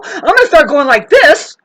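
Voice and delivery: high, loud, laughing burst; acted loud outburst. Acting out a loud reaction.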